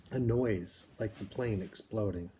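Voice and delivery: usual speaking voice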